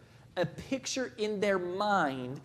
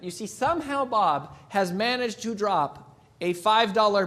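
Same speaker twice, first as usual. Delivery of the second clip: joke storytelling; 5 dB louder than usual. Acted. Tells a joke with flair.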